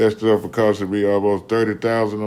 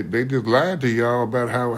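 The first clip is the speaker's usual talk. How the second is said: usual speaking voice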